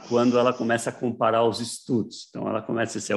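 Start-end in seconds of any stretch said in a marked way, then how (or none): none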